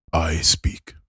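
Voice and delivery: whispered, halting troll speech; a troll's first words in common, whispered; troll in broken common. Acted. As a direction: breathe it out like a troll testing a strange tongue. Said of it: "it's very broken, common".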